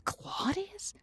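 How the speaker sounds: breathy whispered disbelief, pitch rising; incredulous whisper. Real, not acted. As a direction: whisper the name back, stunned. Asks in disbelief at a name.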